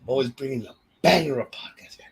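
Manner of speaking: breathy hyped whisper of a catchphrase; hype whisper. Acted. Host whispering his podcast catchphrase to listeners. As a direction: whisper the slogan like a secret chant.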